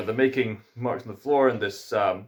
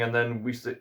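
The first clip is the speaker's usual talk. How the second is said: ordinary delivery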